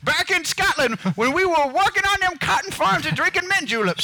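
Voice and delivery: put-on high, loud Southern old man accent; a Southern old-timer spinning yarns. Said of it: "Southern old man".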